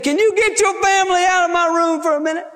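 high, quick, insistent request; high-pitched rush of words; insistent demand. Acted. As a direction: imitate grandpa urgently clearing the room. Grandpa demands family leave room.

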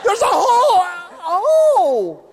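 high drawn-out mock scream; hammed-up terror, shouted at a very high pitch; panicked driver. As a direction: scream in exaggerated alarm as a clueless driver. Acting a panicked, clueless driver.

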